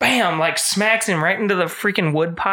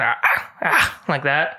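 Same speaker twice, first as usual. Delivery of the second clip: demonstration of a cackle; 3 semitones lower, slower than usual. Shown as a demonstration. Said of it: "for the demonstration".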